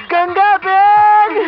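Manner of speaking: high, stretched-out cry of the name; drawn-out insistence. Acted. Husband passing her off as cousin sister, long high insistent cry.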